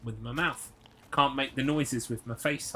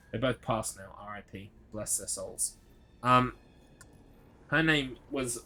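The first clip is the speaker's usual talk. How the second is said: usual speaking voice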